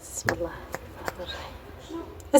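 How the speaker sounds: slow, breathless whisper of a prayer. Real, not acted. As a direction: murmur it slowly under your breath. Nervous prayer before approaching cat.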